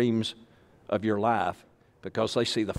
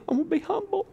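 mock humble listener; faster than usual. Preacher mimics a listener too modest to say it, in a high whine. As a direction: whimper it like a falsely modest churchgoer. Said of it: "You say".

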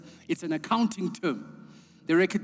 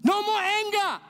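much higher, urgent preaching climax; full-force preaching; 13 semitones higher than usual. Real, not acted. Preacher urging the church to tell the world, full-force preaching. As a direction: lift it up and drive it home.

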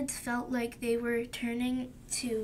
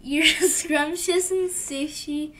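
giggling through a louder line; laughing while reading; 3 semitones higher, 8 dB louder than usual. Real, not acted. Child giggles reading Tomato's praise, louder.

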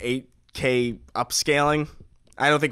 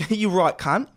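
mock British accent; 5 semitones higher, slower than usual. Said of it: "I have too many British friends".

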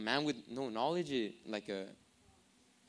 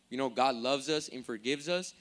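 ordinary delivery

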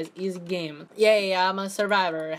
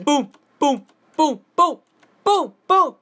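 building, louder booms; mock boom beat; 6 semitones higher, 8 dB louder than usual. Gamer making silly booms for his avatar, building up. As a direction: pump up each boom.

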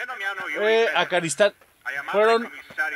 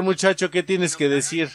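normal talking voice

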